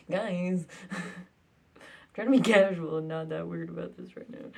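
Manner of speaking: ordinary delivery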